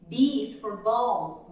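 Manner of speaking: slow, careful "B is for ball"; English teaching model. Shown as a demonstration. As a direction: say "B is for ball" slowly, as for a baby. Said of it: "tem na musiquinha essa parte". (inglês lento e claro)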